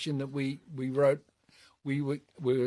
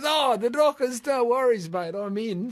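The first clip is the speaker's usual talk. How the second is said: high and loud, thrilled to join in; imitating a punk singer's delighted yes; excited agreement; 8 semitones higher, 4 dB louder than usual. Musician to a train announcer, imitated high excited yes. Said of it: "He goes".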